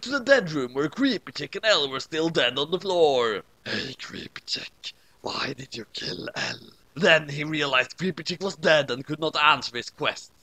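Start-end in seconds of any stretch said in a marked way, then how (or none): 3.6-6.7 soft unvoiced whisper. A narrator's reading. Dawn questioning the dead girl, whispering. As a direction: hush it over the dead body.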